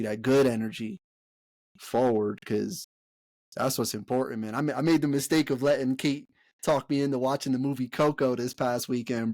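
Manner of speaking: ordinary delivery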